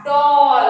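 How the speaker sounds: डॉल said loudly with an open O; loud demonstration of डॉल; model word. Shown as a demonstration. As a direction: sing out डॉल for the class. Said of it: "डॉल में सबसे पहले किसकी sound".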